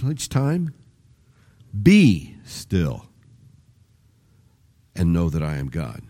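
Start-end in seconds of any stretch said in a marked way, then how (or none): 1.8-6.0 emphasis on "still", rest trailing off; stress on STILL. Shown as a demonstration. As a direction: land the weight on "still", let the rest fall away. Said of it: "you put a different emphasis on each".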